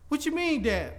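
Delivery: high-pitched imitation of a puzzled child; questioning child. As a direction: play a child questioning a parent, pitched well up. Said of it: "tell my children".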